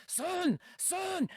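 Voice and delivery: high, slow mock-stern order; dad mocking an overreacting stern order, slow and high. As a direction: drag it out like a comic stern father. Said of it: "Nor am I like".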